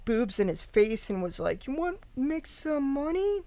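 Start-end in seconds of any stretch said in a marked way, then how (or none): 1.7-3.4 seductive voice. Retold or imitated. Deidre seducing Brian, quoted.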